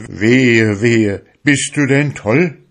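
put-on old priest thundering against the sea folk; priest's outcry. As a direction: rebuke him like a shocked priest. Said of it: "rief aus".

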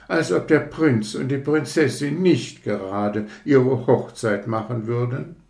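a lightly voiced conceited rocket, touchy and proud; hint of a fussy aristocrat taking offence; vain rocket. Rocket lectures the fireworks about itself, mildly haughty.

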